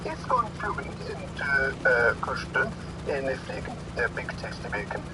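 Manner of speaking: put-on gibberish Norwegian accent with English words popping out; singsong fake Norwegian patter; mock Norwegian comic. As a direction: imitate a Norwegian stand-up routine. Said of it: "went like this".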